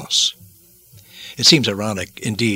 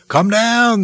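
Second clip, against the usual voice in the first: high, louder jeering call; mocking taunt; 10 semitones higher, 4 dB louder than usual. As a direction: taunt him like a soldier. They said, laughing all the while.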